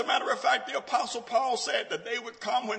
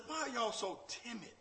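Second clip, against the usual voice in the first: gentle question; 5 semitones higher, 5 dB quieter than usual. Acted. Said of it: "He said".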